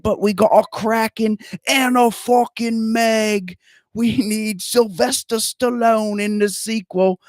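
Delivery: high, very slow, overdone Aussie voice; hammed-up Australian rant on the sequel; awful Australian accent. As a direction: keep the bad Australian going while ranting. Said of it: "Your accent is awful".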